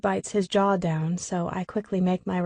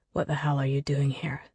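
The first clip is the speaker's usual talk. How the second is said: low hissed whisper, lower and softer; angry whispered sneer; 5 semitones lower, 4 dB quieter than usual. Acted. Declan to the woman who deceived him, whispered sneer. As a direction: sneer it under your breath, furious. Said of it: "sneers angrily under his breath".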